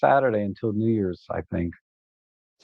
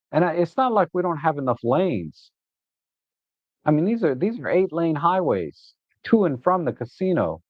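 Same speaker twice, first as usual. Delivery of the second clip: worked-up, high-pitched griping about traffic; exasperated rant; 5 semitones higher than usual. Real, not acted. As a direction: complain in higher, incredulous exasperation. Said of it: "I'm getting upset".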